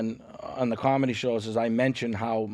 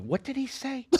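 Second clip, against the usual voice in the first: high, puzzled voice; baffled listener, way up high; confused; 12 semitones higher, 8 dB quieter than usual. Retold or imitated. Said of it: "people like".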